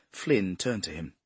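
ordinary delivery

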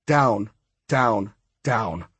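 usual speaking voice

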